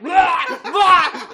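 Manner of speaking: loud, high laughing gag noises; disgusted gagging. Retold or imitated. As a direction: retch and laugh at once. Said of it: "we're like".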